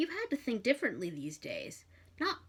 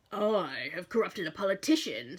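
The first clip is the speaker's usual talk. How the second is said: demon boast; 4 dB louder than usual. Acted.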